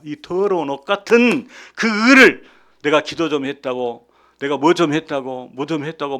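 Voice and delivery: ordinary delivery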